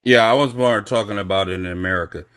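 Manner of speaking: ordinary delivery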